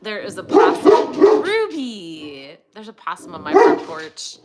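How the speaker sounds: loud, excited announcement of the possum; calling out the news to the dog. Real, not acted. Spots a possum, calls dog.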